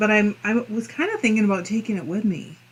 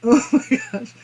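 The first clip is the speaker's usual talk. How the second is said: higher, laughing oh my gosh; amused exclamation; 4 semitones higher than usual. Real, not acted. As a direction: blurt it out mid-laugh. Cracking up at chat.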